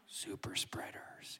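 hushed, sarcastic whisper; mock-fearful whispered aside. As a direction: breathe it like a scary secret. Mocking the church's critics.

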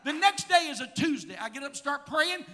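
loud, fervent preaching. Acted. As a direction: preach it with full fire. Preaching at full force.

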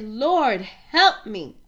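"Lord, help me" cried out loud and pleading; pleading cry. Retold or imitated. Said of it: "And she said".

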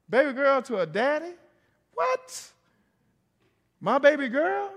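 high and loud, squealing the What; worked-up preacher exclaiming; excited exclaim. Real, not acted. Preacher exclaims about daughters.

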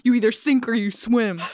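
pumped-up fighting words; fired up. Acted. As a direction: say it like you're squaring up. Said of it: "saying that made me wanna fight".

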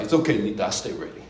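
unflinching, cool and low; calm dad. Retold or imitated. Dad calmly answering mom, low and cool. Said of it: "Dad's like".

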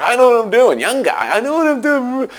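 high, mocking know-it-all voice; cocky driver. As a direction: neighbor mimics the stubborn driver, high and scornful. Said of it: "He's like".

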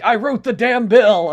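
loud, emphatic retort, lightly imitated; Bernie Sanders. Quoting a debate comeback, loud and emphatic. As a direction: hit it hard as a proud comeback. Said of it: "and Bernie was like".

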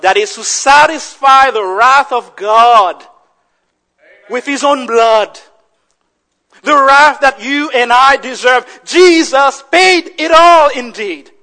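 loud, emphatic proclamation with pauses; full-force preaching. Real, not acted. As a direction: declare it forcefully from the pulpit.

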